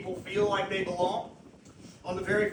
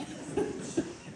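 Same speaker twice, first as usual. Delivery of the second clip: breathy laugh; 4 dB quieter than usual. Real, not acted. Laughing at the repeated quote.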